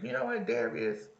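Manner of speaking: a hint of snapping at a friend; retold snap. Teacher retelling snapping at her friend Darius, lightly acted. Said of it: "I was like".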